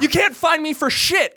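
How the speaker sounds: high-pitched mock-angry taunt; indignant. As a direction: re-enact an outraged retort. Acting out his angry comeback.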